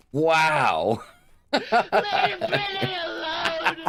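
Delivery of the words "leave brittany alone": very high, slow wail through laughter; hammed-up crying squeal; mock tearful plea. Streamer defending Brittany as a joke, mock tearful wail.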